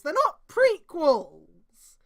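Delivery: drawn-out, loud protest; slow, loud, fed-up correction; exasperated shout. Real, not acted. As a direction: yell it out slowly, in disbelief. Exasperated correction of fanfic author.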